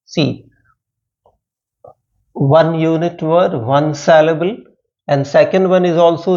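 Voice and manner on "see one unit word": ordinary delivery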